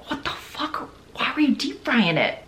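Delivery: shocked hushed whisper; whispered disbelief. Real, not acted. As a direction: gasp it out under your breath. Disbelief at deep frying.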